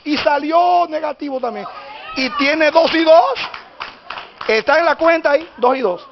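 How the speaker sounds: high preaching, building to a shout; prédica triunfal. Real, not acted. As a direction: proclaim the miracle to the congregation. (prédica exaltada, subiendo hasta el grito)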